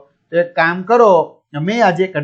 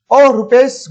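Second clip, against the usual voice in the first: Mr Parikh greeting; 6 semitones higher, 5 dB louder than usual. Acted. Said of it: "mister Parikh".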